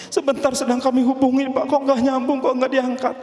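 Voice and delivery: high, flustered worrying aloud; acted panic, pitched right up; panicked father. Father cannot reach groom's family.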